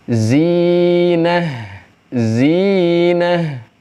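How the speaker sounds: "zinah" with the i drawn out, high; chanted tajwid reading; recitation demo. Shown as a demonstration. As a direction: hold the long i in "zinah". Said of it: "salah satu huruf mad".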